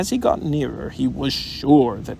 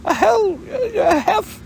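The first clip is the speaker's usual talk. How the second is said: high, loud, panting false starts; breathless Piglet; 5 dB louder than usual. A narrator's reading. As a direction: struggle to squeal the word out, out of breath. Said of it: "breathing so hard that he could hardly speak".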